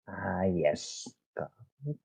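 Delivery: ordinary talk, nothing marked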